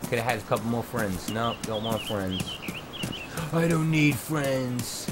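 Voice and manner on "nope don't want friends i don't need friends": slow, sulky mock. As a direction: drag it out like a sulking kid. Mocking the server owner.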